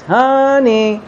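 high sing-song call; lilting husband's homecoming call; calling out to wife. Acted. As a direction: call sweetly through the house, drawn out. Husband calls wife to the door.

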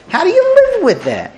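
high-pitched, exasperated question; incredulous outcry. Acted. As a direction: cry it out in sheer disbelief. Acts out Avram's bewildered protest.

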